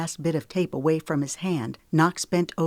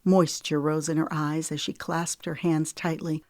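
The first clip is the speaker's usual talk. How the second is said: usual speaking voice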